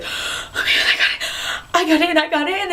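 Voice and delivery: reliving the moment she found out; excited disbelief. Retold or imitated. Telling coworkers the Disney news.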